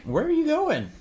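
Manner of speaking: high, loud call after someone leaving. Real, not acted. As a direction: call out as he walks away. Juju leaves the table.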